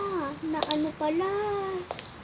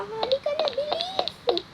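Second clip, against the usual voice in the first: high, hurried-sounding pleas; high-pitched nagging to come along; urgent urging; 11 semitones higher than usual. Acted. Urging nauseous friend to hurry.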